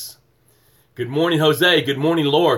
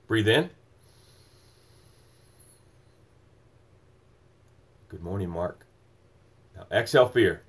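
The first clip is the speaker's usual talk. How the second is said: much softer and lower breath cues; soft, low coaching through a deep breath; guided breathing; 6 semitones lower, 6 dB quieter than usual. Real, not acted. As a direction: hush down and lead the inhale. Leading a breathing exercise.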